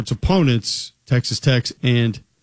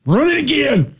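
much higher, barking coach yell; O-line coach impression; 13 semitones higher than usual. Co-host riffing on linemen, high mock coach bark. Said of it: "impersonate offensive line coaches".

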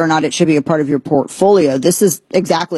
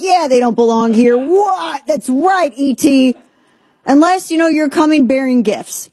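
higher, bouncy agreement; mock excited reaction; 7 semitones higher than usual. Host acting out reading the website, lightly excited and higher. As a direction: play along eagerly, voice lifted. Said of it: "I'm like".

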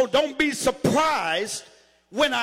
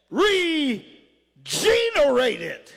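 "regenerated" drawn out as re, then a long GENE-erated; very slow, split "regenerated" to spotlight "gene"; preacher stretching the "gene" in "regenerated"; stress on GENE in regenerated; much slower than usual. Shown as a demonstration. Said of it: "if I put emphasis on one part".

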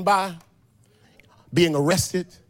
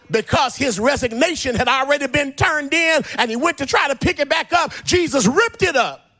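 raised, ringing sermon delivery; full-force preaching; 7 semitones higher than usual. Real, not acted. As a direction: preach it out like a climax, half shouted.

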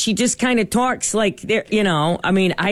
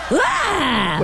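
mock Dean scream; 5 semitones higher than usual. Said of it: "that single".

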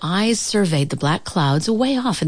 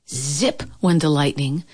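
ordinary delivery

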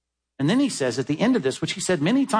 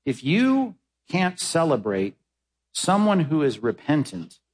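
slow emphasis; slower than usual. Real, not acted. Slowed emphatic appeal.

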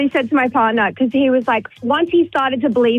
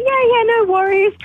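high, light imitation of an agreeable star; easygoing agreement; 9 semitones higher than usual. Caller imitating the star agreeing to a photo, easygoing. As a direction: imitate him saying yes, pitched well up.

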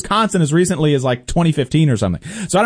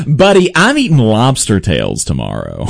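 deep, smug mock of the congressman; smug congressman impression; 8 semitones lower than usual. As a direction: lower the voice and gloat like a careless politician. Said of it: "the first thing that you say".

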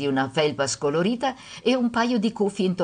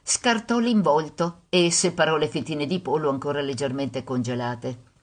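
ordinary delivery

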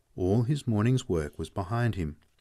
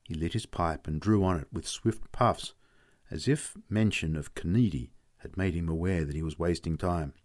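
plain reading voice

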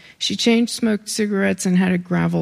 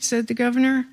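ordinary delivery, nothing marked

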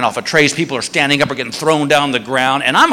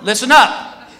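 announcing to a crowd; 3 semitones higher than usual. Retold or imitated. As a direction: address the whole line with authority. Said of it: "he goes to this whole security line".

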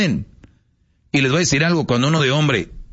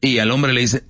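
ordinary talk, nothing marked

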